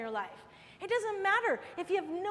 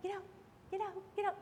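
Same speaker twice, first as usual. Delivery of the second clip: timid get out; 9 dB quieter, faster than usual. Pretend. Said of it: "you wouldn't be like".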